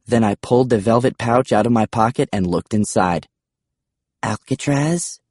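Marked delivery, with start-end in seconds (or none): 4.2-5.2 slow, higher, tentative question; gently raised, careful query. A narrator's reading. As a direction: draw the name out cautiously. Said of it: "Bastille said carefully".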